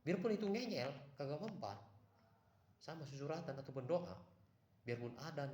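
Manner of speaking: high and loud, insisting; emphatic advice. Real, not acted. Urging listeners to stay confident against ghosts, loud and high. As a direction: drive the point home hard. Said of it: "Saya kasih tahu".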